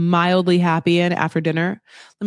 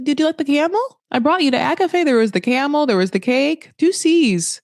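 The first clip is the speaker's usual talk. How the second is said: higher-pitched mock-defensive listing; defensive boyfriend; 7 semitones higher than usual. Boyfriend listing camel and cake, high mock-defensive. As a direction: argue your case as the put-upon boyfriend.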